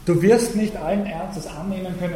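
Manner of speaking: ordinary talk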